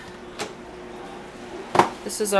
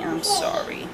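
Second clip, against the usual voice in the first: whispered apology; 12 semitones higher, 5 dB quieter, slower than usual. Real, not acted. Apology to husband Ray.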